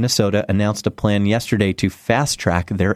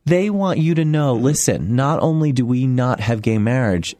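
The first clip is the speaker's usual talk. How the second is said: normal talking voice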